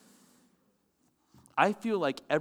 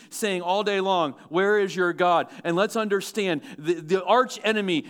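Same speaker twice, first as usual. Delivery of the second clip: loud, raised preaching; preaching at full force; 5 semitones higher, 6 dB louder than usual. Acted. Preacher warning the congregation, loud. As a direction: press the warning hard.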